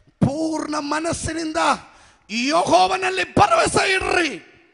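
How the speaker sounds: high, rising scripture proclamation; preaching. Acted. Preaching the verse. (ವಚನವನ್ನು ಏರುತ್ತ ಘೋಷಿಸಿ)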